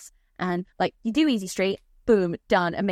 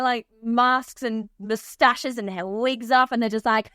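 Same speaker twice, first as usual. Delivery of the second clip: ordinary talk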